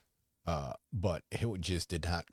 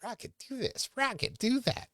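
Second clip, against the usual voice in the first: much higher, squeaky put-on cartoon voice; Rocket Raccoon; 7 dB louder than usual. Retelling a movie scene in a squeaky raccoon voice. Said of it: "where he's like".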